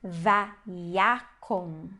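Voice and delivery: "vaiakon" drawn out as va-ya-kon; demonstrated reading. Shown as a demonstration. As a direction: read "vaiakon" very slowly, syllable by syllable. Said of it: "nós temos aqui". ("vaiakon" bem devagar, sílaba por sílaba)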